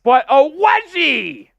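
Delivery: high, loud, drawn-out cheer; going nuclear. Real, not acted. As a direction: stretch it out in a gleeful yell. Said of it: "I go nuclear when we get a wedgie".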